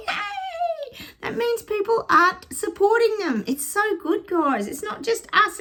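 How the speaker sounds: high, delighted celebration; gleeful cheering. Real, not acted. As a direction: celebrate good news with a squeal in the voice. Cheers the stores closing down.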